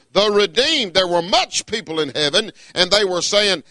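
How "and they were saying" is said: ordinary talk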